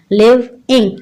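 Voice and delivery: लिविंग in two parts, "liv - ing"; teacher's two-beat लिविंग; split into syllables. Shown as a demonstration. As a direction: break लिविंग after the v. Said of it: "two parts में पढ़ते हैं".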